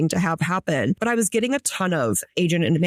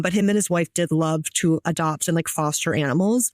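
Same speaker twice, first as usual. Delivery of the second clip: usual speaking voice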